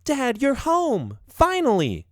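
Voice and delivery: put-on high-pitched little girl, drawn-out greeting; young daughter. A narrator's reading. Daughter greeting dad coming home, high girlish voice. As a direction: stretch it out in a high child's voice. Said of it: "his daughter says".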